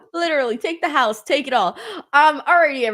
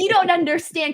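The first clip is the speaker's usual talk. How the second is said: higher, pleading retold protest to husband; whiny retold plea; 7 semitones higher, 3 dB louder than usual. Retelling to the host her protest to husband, whiny and pleading. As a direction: whine it up at him like she did. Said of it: "And I was like".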